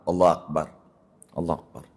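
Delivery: Allahu Akbar low and soft, fading; correct takbir repeated. Shown as a demonstration. Teacher repeating the correct takbir softly. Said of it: "Kudu begitu".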